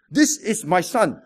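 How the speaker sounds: usual speaking voice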